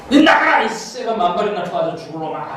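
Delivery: mock yell, high at first; half-hearted shout to get out; retold yell. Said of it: "그럼 소리를 지른다고". (할머니 흉내로 가볍게 외치는 욕)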